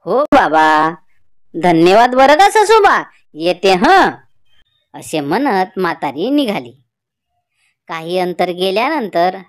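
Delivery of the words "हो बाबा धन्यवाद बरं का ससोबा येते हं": put-on louder, slow old woman's voice; old grandmother. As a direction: speak as the village grandmother, slow and loud. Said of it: "म्हातारी म्हणाली".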